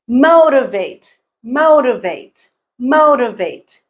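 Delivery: motivate slowed, MO long and strong; first-syllable stress. Shown as a demonstration. Teacher showing learners word stress, motivate stressed on MO. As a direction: stretch and punch MO in motivate. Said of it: "Mo is the stressed syllable".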